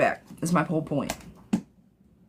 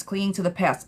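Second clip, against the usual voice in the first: ordinary delivery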